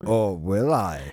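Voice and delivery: slow, teasing mystery; mock mysterious. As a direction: drag it out like a riddle.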